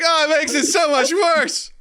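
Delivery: high, slow mock wail; hammed-up suffering, drawn out; anguished egg eater. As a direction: lament it in exaggerated misery. Acts out forced egg eater.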